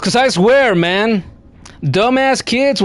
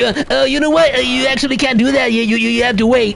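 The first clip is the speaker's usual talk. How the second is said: mocking know-it-all; 5 semitones higher, faster than usual. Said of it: "I have Vinay here again ... to tell me".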